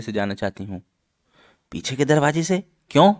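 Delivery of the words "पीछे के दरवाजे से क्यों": high, rising disbelief; surprised echo question. Acted. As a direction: echo it back as a puzzled question. Grandfather echoes her in disbelief.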